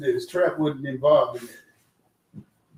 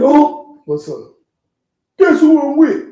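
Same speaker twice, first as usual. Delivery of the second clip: much higher, louder mock voice of the friend; imitating the caller; 11 semitones higher, 8 dB louder, slower than usual. Friend calling to brag who he's with, high loud mock voice. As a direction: squeal it out like the excited friend on the phone. Friend's phone call retold.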